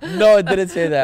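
laughing protest. Real, not acted. Co-host laughs off the made-up review line, laughing protest. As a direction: laugh the denial out.

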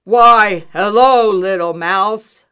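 booming, very loud bear growl. A narrator's reading. Bear greeting Little Mouse, booming growl. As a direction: growl it out big and loud, slowing at the end. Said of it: "growled Bear".

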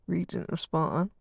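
usual speaking voice

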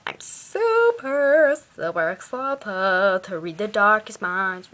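very slow, stretched-out mock enthusiasm; hammed-up excitement. Vlogger overdoing eagerness for the next book, hammed-up.

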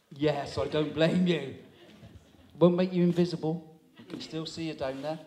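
ordinary talk

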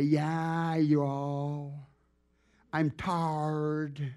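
lazy Mississippi drawl, imitated; mock Mississippi drawl, mildly done; laid-back Mississippi drawl. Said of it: "those people are so laid back".

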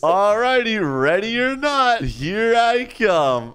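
high, slow, stretched seeker's call; singsong ready-or-not announcement; playful calling out. Pretend. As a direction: draw it out to warn the hiders. Seeker calls out to hiders.

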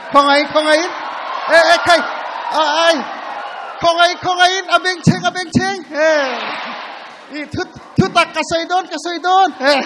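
high, loud playful telling-off; mock scolding. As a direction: scold them loudly, half joking.

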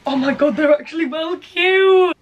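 loud, high squeal of delight over the shoes; excited gush. Real, not acted. To viewers over adorable sandals, an excited loud gush. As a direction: gush like you've just fallen for them.

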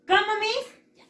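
put-on high, loud, drawn-out child's question; baffled pupil. Pupil asking Miss to say it again, baffled. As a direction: whine it as a lost pupil.

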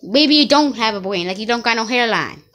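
ordinary talk

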